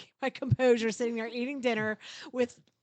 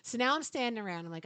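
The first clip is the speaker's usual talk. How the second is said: normal talking voice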